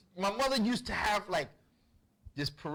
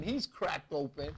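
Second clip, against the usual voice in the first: usual speaking voice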